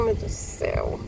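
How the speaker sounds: breathless whisper of shock; whispered disbelief. Retold or imitated. Waitress watching the father reward the kids, whispered. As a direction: whisper it, horrified.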